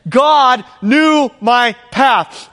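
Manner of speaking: drawn out word by word; slow it right down on God knew my path; deliberate, measured preaching; very slow emphasis. Acted. Preacher repeats key line.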